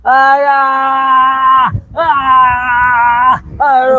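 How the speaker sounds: loud, high pained cries; pained scream. Acted. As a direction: scream out as if burning. Possessed man screams in pain.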